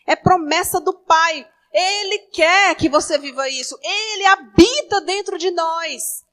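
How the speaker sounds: loud, driving sermon delivery; fervent preaching. Acted. Pastor urging the church to receive the Spirit, fervent preaching. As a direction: preach it with full conviction.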